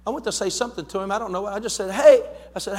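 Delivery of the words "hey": a sudden loud call to a stranger on the street. Retold or imitated. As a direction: shout it from the truck window to get his attention. Calling out to a stranger.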